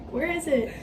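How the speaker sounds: normal talking voice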